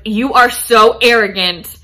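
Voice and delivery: a hammed-up slow insult; mock insult. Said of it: "she starts insulting him".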